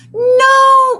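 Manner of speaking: high, loud cry of dismay. A narrator's reading. A child crying out to her teacher, loud and high. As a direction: cry out in shock. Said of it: "cried Missy".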